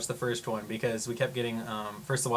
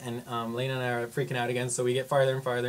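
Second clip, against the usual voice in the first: normal talking voice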